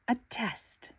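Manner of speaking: whispered thrill; whispered excitement; breathless awe. Acted. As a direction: breathe it out in delight. SpongeBob thrilled about test.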